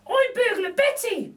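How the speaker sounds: very high, slow, chirpy lady voice; a cheeky cockney burglar lady; Burglar Betty. A narrator's reading. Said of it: "says the lady".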